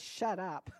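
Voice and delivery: low, understated irritation; a touch of fed-up parent; mildly frustrated. Acted. Parent to kids in the back seat, mildly frustrated. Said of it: "If you're frustrated".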